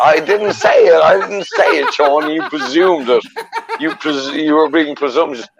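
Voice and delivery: high laughing denial; laughing protest. Real, not acted. Teased, protesting through laughter.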